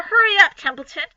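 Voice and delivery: high, loud hurry-up; urgent plea. Acted. Wilbur urging Templeton to hurry, high and loud. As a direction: press the rat to hurry.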